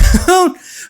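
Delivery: high, loud mock-evil laugh; villainous laugh. Cerise laughing at her old self, high mock-evil laugh. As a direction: cackle like a villainess.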